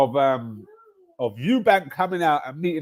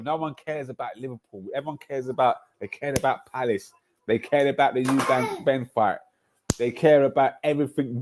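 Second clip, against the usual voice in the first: ordinary talk, nothing marked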